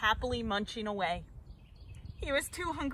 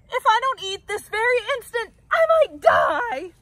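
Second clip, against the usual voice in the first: melodramatic wail; 8 semitones higher, 9 dB louder than usual. A narrator's reading. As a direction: overplay the dying. Starving Bird dramatic plea.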